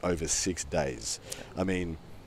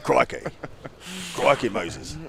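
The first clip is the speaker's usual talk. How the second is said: louder, hammed-up Australian exclamation; crikey, played up like a cartoon Aussie; mock Australian; 6 semitones higher, 3 dB louder than usual. Hamming it up for his cohost in a loud mock Australian accent. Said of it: "you're already speaking in Australian".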